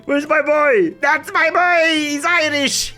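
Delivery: loud, high, laughing father's cheer; proud excited cheer. Real, not acted. Proud father greeting his son.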